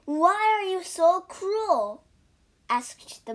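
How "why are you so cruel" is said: high, loud reproach; raised voice, accusing; reproachful outcry. Acted. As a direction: protest loudly at the cruelty. Monk reproaches the monkey.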